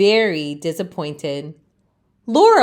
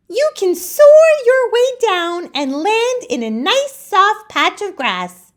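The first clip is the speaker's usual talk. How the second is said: much higher, bright helper voice; a cheerful friend with a plan, pitched way up; eager friend Laurel; 12 semitones higher, 5 dB louder than usual. Acted. Laurel encourages Leaf, much higher voice. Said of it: "Laurel exclaims".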